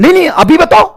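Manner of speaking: put-on high, loud childish insistence; mocking insistent student. Student refusing to wait for the teacher, high whine. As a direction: demand it now like a spoilt kid.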